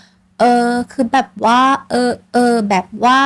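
slow, drawn-out mock hesitation; hesitant filler words. Shown as a demonstration. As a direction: imitate someone stalling for words. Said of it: "ตัดคำฟุ่มเฟือยทิ้งค่ะ พยายามลดคำว่า".

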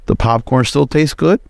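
ordinary talk

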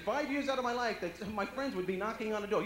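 usual speaking voice

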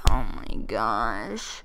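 low, slow recovery after fright; shaken relief. Real, not acted. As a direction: drag it out, low, after a scare. Recovering from a scare.

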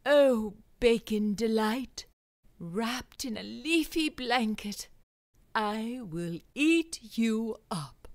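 slow, dramatic recitation; Wilfred's hammed-up poem. Wilfred reciting his bacon poem slowly and grandly. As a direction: read it like a grand ode.

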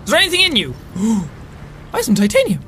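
usual speaking voice

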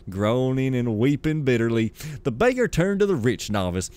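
lively, sing-song storybook reading; story narration. A narrator's reading. Gamer reading lore aloud as a tale, storytelling voice. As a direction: read it like a grand old fable.

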